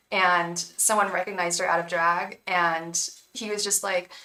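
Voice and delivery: normal talking voice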